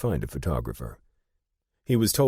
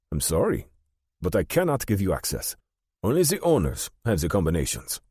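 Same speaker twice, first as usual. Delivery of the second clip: put-on raised-pitch German-accented banker; German-accented refusal, voice pitched up; 6 semitones higher than usual. A narrator's reading. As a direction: decline politely but firmly in a German accent. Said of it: "In a noticeable German accent".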